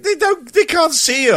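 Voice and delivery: high, loud incredulous complaint; exasperated protest. Retold or imitated. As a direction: protest to the boss in disbelief. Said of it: "And I'm like".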